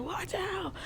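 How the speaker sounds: high, soft mimed shout; mock yell. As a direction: imitate yelling a warning without the volume. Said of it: "I'm yelling at her".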